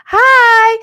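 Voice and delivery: high, loud shouted hi. Real, not acted. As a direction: belt out the greeting. Sudden loud greeting to chat.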